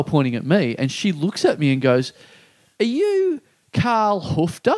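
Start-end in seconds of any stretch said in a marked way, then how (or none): 2.8-4.7 higher, slow and puzzled, dragging the name out; confused fan. Retold or imitated. A fan outside the pub asking Tommy, high slow puzzled. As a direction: squint at him and drawl the wrong name. Woman mistakes him for someone.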